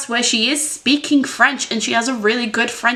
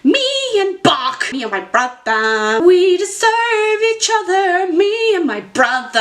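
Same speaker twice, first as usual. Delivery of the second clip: higher, slow, half-sung mock-theatrical imitation; imitating the Wicked actress; 7 semitones higher than usual. Mocking the Wicked actress's line to viewers, hammed-up sing-song. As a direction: ham up her dramatic sing-song delivery. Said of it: "when she goes".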